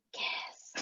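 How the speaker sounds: voiceless ghostly whisper. Acted. Unseen ghost urging two trapped friends to kiss, whispered. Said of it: "they just hear the whispers".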